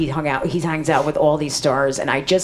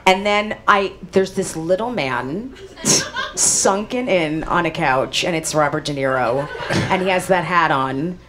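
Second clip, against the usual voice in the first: ordinary delivery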